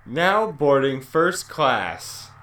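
slow, loud mock boarding call; over the intercom. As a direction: announce it like a rude gate agent.

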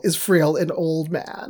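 ordinary delivery

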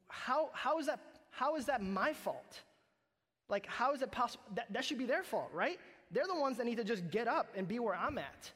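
high, loud, indignant protest; indignant outrage. Retold or imitated. Pastor retelling his offended reaction to the verse, high indignant protest. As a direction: replay your own offended outburst, pitch up. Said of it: "That's literally what I was feeling".

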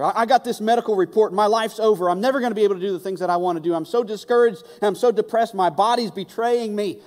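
a hint of a broken patient; discouraged patient. Preacher voicing a sick believer, hurried.

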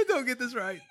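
high, laughing through the words; squeaky laughing plea. Real, not acted. Watching him eat.

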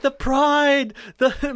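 high, loud lament in a retold quote; mock-tearful, high-pitched cry. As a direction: wail it out like a broken man, hammed up. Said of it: "He's crying. He's like".

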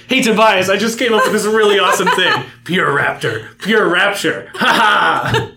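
loud, high, laughing and proud; excited. Retold or imitated. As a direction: brag about it, laughing. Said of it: "then she says".

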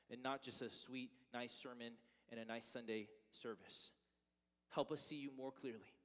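soft, earnest prayer voice; pastoral prayer. Acted. Pastor praying before the sermon, soft earnest prayer. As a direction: pray sincerely for the congregation.

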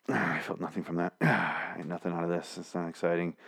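like pulling weeds, sigh it out; imitating bored Carol, sighing and quiet. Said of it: "Carol looks super bored".

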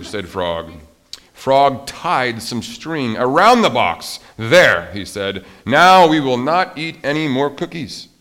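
loud, lifted, deliberate read-aloud; story reading. A narrator's reading. Teacher reads Frog and Toad's cookie debate to children, animated storytelling. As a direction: act out the dialogue boldly for young listeners.

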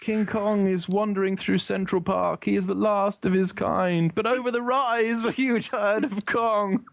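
slow, high, mock-grand nature narration; Kenneth Branagh impression. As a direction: narrate a parody documentary, swelling toward the reveal. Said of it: "put on my best Kenneth Branagh voice".

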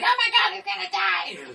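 high-pitched panicked shriek; panicked mom. Retold or imitated. Mom screams as her son hits the water, panicked. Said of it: "Mom's like".

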